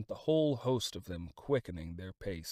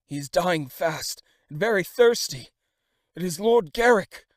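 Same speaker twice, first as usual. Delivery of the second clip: 1 urgent plea; 10 semitones higher, 15 dB louder than usual. A narrator's reading. Desperate servant begs for water.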